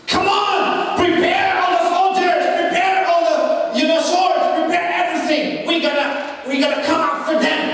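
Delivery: rally the soldiers with a shout; Pharaoh's shouted orders. Acted. Pharaoh shouting orders to his soldiers, commanding.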